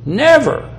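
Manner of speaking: Never pitched high, standing alone; stress on Never. Shown as a demonstration. Preacher driving home the verse's first word, stress on Never. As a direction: lift Never sharply and let it fall. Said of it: "I'm going to emphasize that first word".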